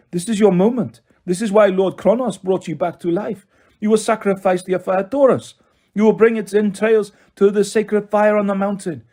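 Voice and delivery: French villain's rousing temptation; Manticore. A narrator's reading. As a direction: urge with mounting fervour in a French accent. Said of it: "in a heavy French accent".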